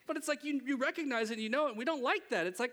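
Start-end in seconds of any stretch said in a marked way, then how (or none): none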